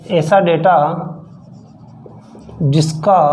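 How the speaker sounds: chunk by chunk, long pauses, the last words slowed; slow dictation. Shown as a demonstration. Teacher dictating to students, slow and word by word. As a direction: spell it out slowly for note-taking.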